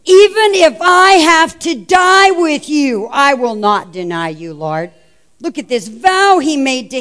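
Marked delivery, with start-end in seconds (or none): 0.1-4.9 slow, loud, emphatic vow; emphatic insistence. Retold or imitated. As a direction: insist hard, drawing it out. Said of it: "Peter insisted emphatically".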